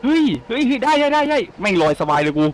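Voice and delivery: high gleeful whoops while gliding; excited whoop. Real, not acted. Player gliding after a jump, high gleeful whoops. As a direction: cheer as he floats free.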